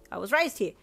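forceful, tactless statement; blunt. Acted. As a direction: announce it with no softening. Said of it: "he said bluntly".

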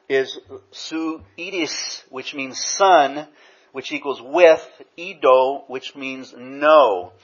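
slow, deliberate word breakdown; slow explanation. Shown as a demonstration. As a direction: spell out the Greek slowly. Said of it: "That word conscience in the Greek".

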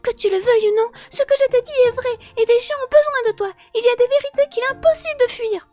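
high, loud, forceful female character; hard, cutting. A narrator's reading. Sister stops brother from walking away, hard cutting voice. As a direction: drive each phrase home, hard and loud. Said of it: "sa voix était devenue dure et tranchante".

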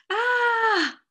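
drawn-out "Ah!", shouted high; high anguished shout. A narrator's reading. Badger as his garden washes away, high shout. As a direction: cry out loud and long in alarm. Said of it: "shouted Badger".

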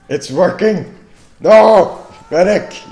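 loud, high triumphant then panicked yell; excited shout. Real, not acted. Plan works then dies.

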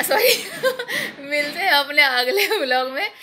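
embarrassed laughing apology; laughing at a slip. Real, not acted. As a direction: giggle through the sign-off after the flub.

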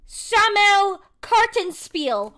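slow, careful "Samuel Kartenspiel" sounded out word by word; unsure German reading. Real, not acted. Streamer puzzling over German words on a card, slow and careful. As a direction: spell out the German name slowly. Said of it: "how the frick do you say this word?".

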